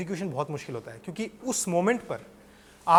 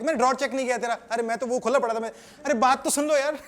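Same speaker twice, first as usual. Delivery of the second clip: higher, louder mock bickering; escalating quarrel; 4 semitones higher, 4 dB louder, faster than usual. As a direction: protest over each other, building to an exasperated plea. Quarrel escalates, both interrupt each other. (बढ़ती बहस में झुंझलाकर बोलना)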